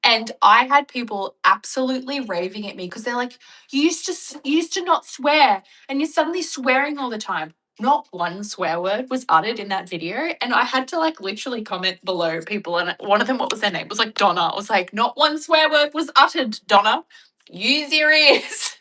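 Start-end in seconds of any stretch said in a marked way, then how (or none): 3.7-7.5 whiny mock accuser, much higher and louder; mocking commenter. 14.9-18.8 loud, higher, very slow on use your ears; sassy retort. Retold or imitated. Retorting to commenter Donna.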